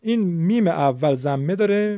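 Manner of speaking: usual speaking voice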